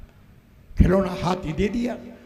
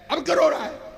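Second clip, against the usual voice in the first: exasperated shout; 8 semitones higher, 5 dB louder, faster than usual. Acted. Exasperated parent asks crying child.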